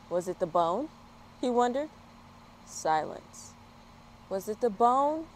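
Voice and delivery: lively storybook reading voice; bright, animated picture-book narration; reading to children. A narrator's reading. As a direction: read it out like story time for little ones. Reads a children's story aloud.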